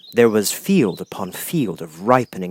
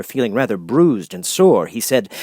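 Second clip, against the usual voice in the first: ordinary delivery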